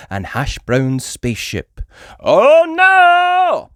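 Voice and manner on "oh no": very high, loud cry of alarm; alarmed shout. A narrator's reading. Super Potato facing the space carrots, loud hammy alarm. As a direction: yell in hammy panic. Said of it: "shouted Super Potato".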